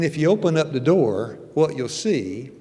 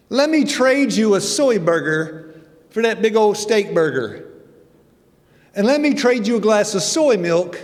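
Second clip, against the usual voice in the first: much higher, louder, sweetly persuasive voice; mock Adventist offering a trade; 7 semitones higher, 6 dB louder than usual. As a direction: coax him to swap the steak burger. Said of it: "one of you Adventists say".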